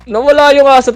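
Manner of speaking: high, loud cry of surprise; alarmed shout. Real, not acted. Player telling squad the dog vanished, alarmed shout.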